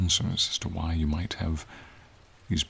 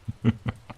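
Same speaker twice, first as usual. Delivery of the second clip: brief, amused chuckle; a quick little laugh; short chuckle; 4 semitones higher than usual. Acted. Dragon amused by the human's answer, short chuckle.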